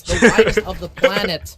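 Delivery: laughing through the call. Real, not acted. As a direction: crack up while casting. Caster laughs mid-call.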